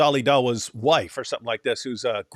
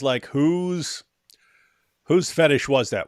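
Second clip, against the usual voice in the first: ordinary delivery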